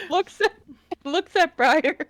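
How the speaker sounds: laughter breaking into the words; trying not to laugh. Real, not acted. Player describing Wimdy sitting up, struggling to stop laughing. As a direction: narrate while fighting off giggles. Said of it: "I need to get to stop laughing".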